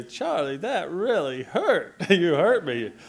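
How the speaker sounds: high, loud, slow mock complaint; hurt little boy. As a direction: imitate a wounded child scolding the baby. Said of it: "and then Charlie".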